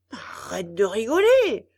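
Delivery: loud angry scold. A narrator's reading. Geppetto scolding the laughing puppet mouth, angry. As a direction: tell the laughing mouth off hard. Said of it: "gronda Geppetto".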